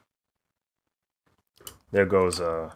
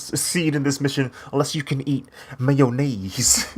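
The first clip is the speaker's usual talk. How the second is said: scientist voice, laughing; 5 semitones higher than usual. Retold or imitated. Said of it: "That's how he said it".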